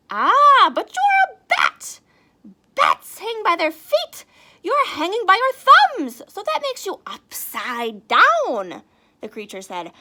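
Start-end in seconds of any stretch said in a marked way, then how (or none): 0.1-8.8 very high, loud squeaky bat; know-it-all bat. Acted. The bat explains to Stellaluna, high and loud. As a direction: explain it like a smug little bat.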